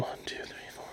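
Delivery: whispered count; barely audible, voiceless counting to oneself; under the breath. Real, not acted.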